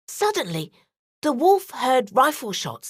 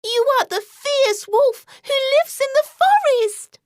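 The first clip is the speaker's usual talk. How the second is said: put-on much higher, louder girlish voice; girl naming the wolf, higher and louder; little girl; 14 semitones higher, 5 dB louder than usual. A narrator's reading. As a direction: announce it like a bold little girl.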